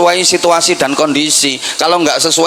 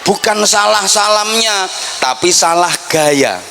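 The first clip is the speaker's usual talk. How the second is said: usual speaking voice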